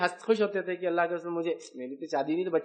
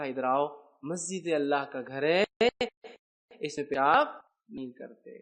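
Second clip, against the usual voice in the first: gentle explaining; 6 semitones lower than usual. Retold or imitated. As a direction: explain kindly to the villager. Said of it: "اس کو بلایا اور کہا". (نرمی سے سمجھانا، دھیمی آواز)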